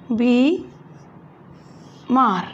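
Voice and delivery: बी and मार split with a pause; syllable by syllable. Shown as a demonstration. Teacher spelling बीमार for class, split into syllables. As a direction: spell it out in two beats. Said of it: "बड़े की मात्रा आएगी".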